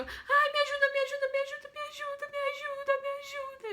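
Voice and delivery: high, desperate cries of me ajuda; retold screaming for help. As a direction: wail for help in labour pain. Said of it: "Eu gritava assim, ó". (gritos agudos de socorro)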